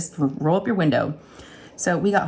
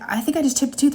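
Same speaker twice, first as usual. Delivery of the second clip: worried; 8 semitones higher, faster than usual. Retold or imitated. Retold alarmed self.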